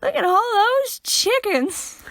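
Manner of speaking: high, loud, slow put-on voice; imitating the famous chicken Vine; Vine quote voice. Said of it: "quote Vines".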